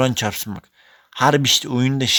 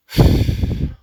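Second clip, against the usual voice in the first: loud frustrated sigh; 6 dB louder than usual. Real, not acted. After conceding again.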